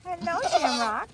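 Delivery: a lighter coo at the animal; pet-talk voice. Real, not acted.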